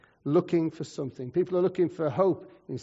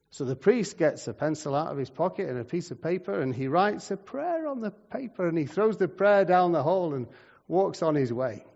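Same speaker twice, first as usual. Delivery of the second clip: normal talking voice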